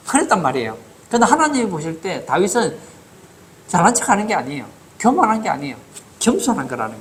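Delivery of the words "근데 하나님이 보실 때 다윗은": ordinary delivery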